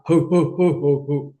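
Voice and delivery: rounded hoo-hoo in even beats; Santa laugh. Shown as a demonstration. Teacher demonstrating to class, Santa-style laugh. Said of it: "like Santa".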